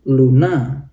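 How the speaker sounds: luna with the stress on the final a; end stress demo. Shown as a demonstration. Teacher showing final-syllable stress on luna. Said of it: "di A yang terakhir".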